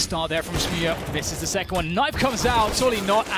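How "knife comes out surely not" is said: rising caster hype, high and loud; excited. Real, not acted. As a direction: call it like the crowd's on its feet. Caster reacting to knife play.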